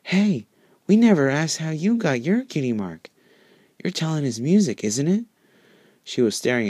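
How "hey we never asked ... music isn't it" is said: much higher, eager little filly voice; brightened up. Acted. Sweetie Belle asks Lyra about her cutie mark: bright, high, eager. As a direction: ask it like a curious, excited child. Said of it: "Sweetie Belle's face brightened up".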